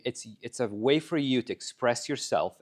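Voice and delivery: ordinary delivery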